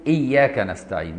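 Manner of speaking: إياك نستعين said without the leading waw; dropped waw. Shown as a demonstration. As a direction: start straight on إياك with no و before it. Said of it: "ما قالش".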